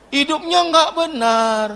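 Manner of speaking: ordinary delivery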